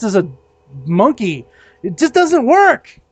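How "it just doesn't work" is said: high-pitched, worked-up tirade; venting rant. Real, not acted. Fed-up fairgoer telling off demon-slaying role players, heated venting. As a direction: let it all out at the fantasy crowd. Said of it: "I feel better".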